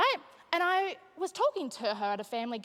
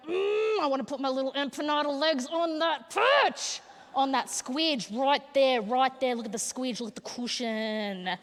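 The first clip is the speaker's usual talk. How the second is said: put-on baby's voice; 3 semitones higher, 3 dB louder than usual. Comedian voicing a baby eyeing her chest. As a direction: coo over the cushion like a hungry baby. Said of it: "baby will just go".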